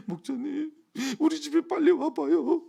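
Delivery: high-pitched mock crying voice; imitated tearful plea. Woman deacon phoning her pastor for help, imitated tearful plea. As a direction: wail it like a panicked caller begging. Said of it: "막 울어요".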